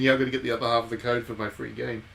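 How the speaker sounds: ordinary talk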